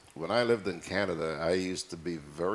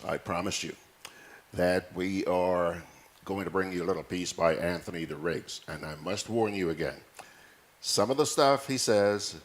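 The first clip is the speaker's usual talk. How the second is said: ordinary talk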